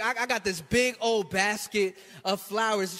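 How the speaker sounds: ordinary talk, nothing marked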